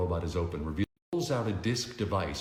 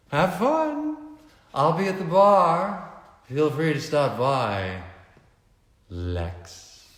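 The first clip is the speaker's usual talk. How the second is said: much higher, sing-song flirty voice; coquettish socialite; 8 semitones higher, 7 dB louder, much slower than usual. A narrator's reading. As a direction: flutter the goodbye playfully. Veronica leaves for the bar.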